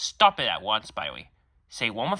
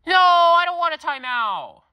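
high, loud, slow wail; whining protest; 14 semitones higher, 7 dB louder than usual. Acted. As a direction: whine it like a child refusing. Joker refusing timeout.

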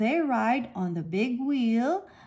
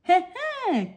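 sly higher heh heh; a short villain cackle; 7 semitones higher than usual. A narrator's reading. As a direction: shark chuckling over his dinner. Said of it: "cackles Shark".

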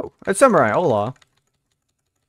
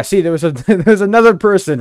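loud, laughing, rising; 7 semitones higher, 9 dB louder, faster than usual. Real, not acted. Streamer laughing while telling chat a story. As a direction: laugh through it.

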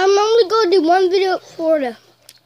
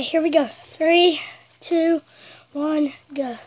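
normal talking voice

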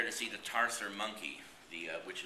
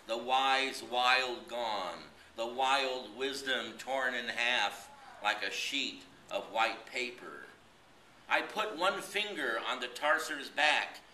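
slow, measured poem recital, higher and louder than chat; poetry reading; 4 semitones higher, 5 dB louder, slower than usual. Acted. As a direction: read it out as a poem, drawn-out phrases with pauses between lines. Recites a poem after chatty introduction.

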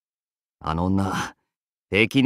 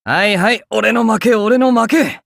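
higher and louder, throwing up his hands; exasperated surrender; 9 semitones higher, 5 dB louder than usual. Acted. Archer loudly conceding defeat to his stubborn master. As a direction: give in with a loud laughing groan.

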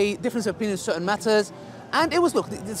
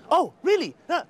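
mock eager; 8 semitones higher than usual. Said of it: "She all of a sudden lit up".

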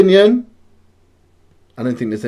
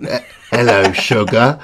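breathy vintage purr. Acted. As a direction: croon it like an old-time charmer. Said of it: "What was that".

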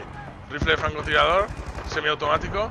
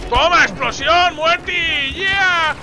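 high, loud exultant yell; triumphant battle shouting; 6 semitones higher, 4 dB louder, much slower than usual. Pretend. Player cheers explosion and kill, triumphant shouting. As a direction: crow over the hit at full voice. Celebrates kill, warns of planes.

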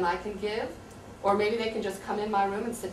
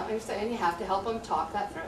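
ordinary talk

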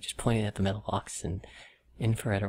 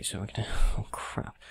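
normal talking voice

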